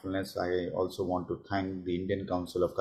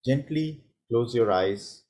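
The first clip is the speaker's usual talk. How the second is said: guided meditation. Real, not acted. Host leads attendees through a minute's check-in, guided meditation. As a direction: guide them gently inward, leaving long pauses between phrases.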